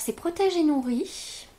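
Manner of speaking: ordinary talk, nothing marked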